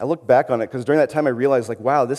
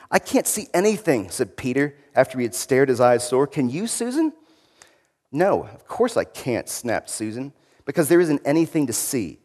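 story reading aloud; 3 semitones higher, 5 dB louder than usual. Acted. Reads Narnia passage aloud.

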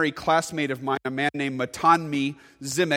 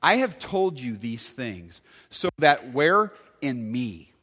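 scripture reading; 2 semitones lower, slower than usual. Acted. Reading Jesus' words.

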